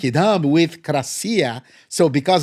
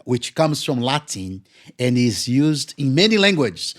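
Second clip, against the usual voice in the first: ordinary talk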